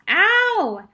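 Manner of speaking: very high, loud cry of pain; sharp pain yelp. A narrator's reading. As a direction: yelp as if suddenly pinched. Splinter squeezed out of cheek.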